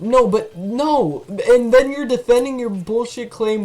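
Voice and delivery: high, loud, indignant objection; shouting in protest. Real, not acted. Streamer calling out the game's defense of its claim, shouting in protest. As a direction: shout your objection at the game. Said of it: "Shouting at A I".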